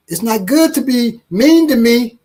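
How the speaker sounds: put-on high, slow little girl scolding. Three-year-old daughter scolding her father, high, slow and indignant. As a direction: pipe up like a three-year-old telling off dad. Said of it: "And she'll say".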